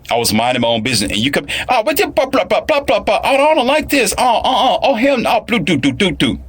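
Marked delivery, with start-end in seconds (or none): 1.7-6.4 high-pitched hysterical babble, imitated; hammed-up falsetto tirade; mock irate rant. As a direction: mimic her ranting nonsense way up high. Mimics the irate woman's rant.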